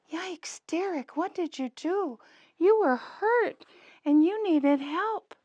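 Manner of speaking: high, startled yikes; surprised alarm. Acted. Teacher startled by puppet's story, high alarmed voice. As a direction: gasp it out, worried for him.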